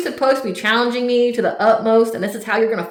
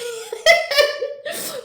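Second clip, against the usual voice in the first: real squealing laugh, much higher. Real, not acted. Reader cracks up at the ex's weak tactic, high squealing laugh. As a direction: break into a high, giddy laugh. Bursts out laughing mid-rant.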